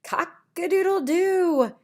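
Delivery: loud, high crowing cock-a-doodle-doo; rooster crow. Acted. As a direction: crow like a proud rooster for the kids. Rooster in farm picture book.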